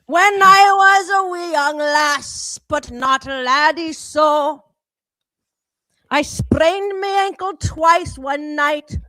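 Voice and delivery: slow mock Irish lilt; lightly put-on Irish accent, singing the tale. Friend spinning a drinking tale in a light Irish accent. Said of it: "trying to sound Irish".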